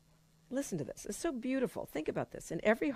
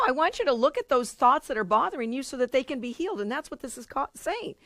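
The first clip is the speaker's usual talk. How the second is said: ordinary talk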